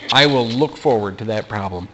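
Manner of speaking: usual speaking voice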